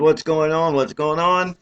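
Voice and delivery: mock panic. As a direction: replay the moment of mild panic. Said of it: "I'm, like, panicking".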